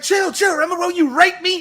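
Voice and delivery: high-pitched imitated confrontation; hammed-up, accusing high yell; mock yelling. Podcaster acting out a bandmate's confrontation, mock yelling. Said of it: "if somebody yelled at me".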